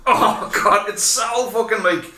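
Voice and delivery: imitating a loudly complaining partner; high, loud mock groan of protest; exasperated missus. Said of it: "She's like".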